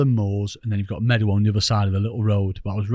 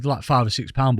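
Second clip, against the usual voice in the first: ordinary talk, nothing marked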